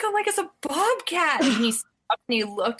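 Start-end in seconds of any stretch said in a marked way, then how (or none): 0.3-1.4 alarmed. Retold or imitated. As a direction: warn him urgently. Alarmed about bobcat.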